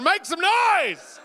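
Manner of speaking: high, loud drawn-out rallying yell; hype shout. Real, not acted. Host to a talent show audience, rousing hype shout. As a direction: shout it out to rev up the crowd.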